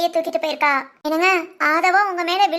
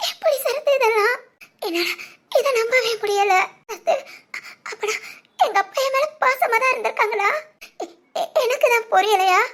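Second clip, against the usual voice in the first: high, tearful laughing sobs; crying through laughter; 5 semitones higher than usual. Acted. Daughter overwhelmed by her late father's gift, crying and laughing. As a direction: laugh and cry at once over a father's gift. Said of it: "அழாதடி".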